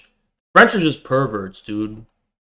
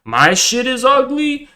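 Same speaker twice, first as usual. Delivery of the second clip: incredulous; 9 semitones higher than usual. Real, not acted. Outraged at a hater's insult.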